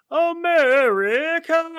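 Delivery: very high, slow, loud yell; war cry. Retold or imitated. From the tank to the Russians, a slow, high war cry. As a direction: howl it like a charging soldier. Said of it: "and then".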